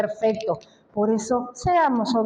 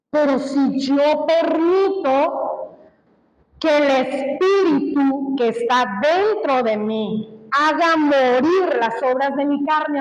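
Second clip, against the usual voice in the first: building preaching. Acted. Preacher urging the congregation to let the Spirit win, building fervour. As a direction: preach it with growing force, listing the costs.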